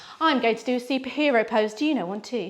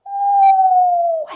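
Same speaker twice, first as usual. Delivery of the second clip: breathy woo; 12 dB louder than usual. Acted. Going down the slide.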